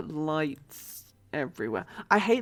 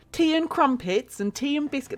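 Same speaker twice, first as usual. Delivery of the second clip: Americans' bad British accent, pitched up; higher-pitched put-on British; mock British accent; 8 semitones higher than usual. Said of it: "whenever they try and do this, like, the British accent".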